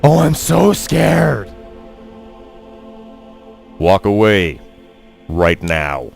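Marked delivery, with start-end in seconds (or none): 0.0-1.5 mock-scared, high and drawn out; sarcastic. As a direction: pretend to be terrified, slowly. Mocking the bandit's threat.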